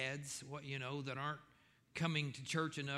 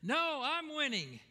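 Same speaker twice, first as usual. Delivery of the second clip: put-on kid voice; 11 semitones higher, 4 dB louder than usual. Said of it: "A third said".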